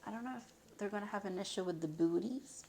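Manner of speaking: normal talking voice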